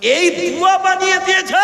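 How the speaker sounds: very high, loud indignant cry; outraged shout. Real, not acted. Preacher shouting in outrage that the prayer was invented. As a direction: hurl it at the crowd in outrage.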